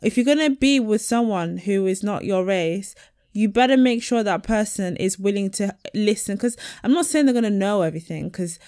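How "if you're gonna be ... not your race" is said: usual speaking voice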